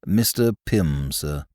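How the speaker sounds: slow, low, quiet manservant; measured, deferential valet; Jeeves, the butler. Acted.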